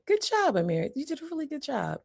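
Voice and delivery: high, sing-song proud parent; delighted praise. Acted. Parent praising a young son, delighted. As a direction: praise a child in a high, gushing voice. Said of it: "He notices the difference in that".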